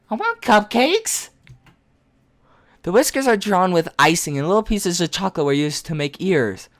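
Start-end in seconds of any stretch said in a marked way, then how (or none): 0.1-1.3 put-on whiny childlike voice.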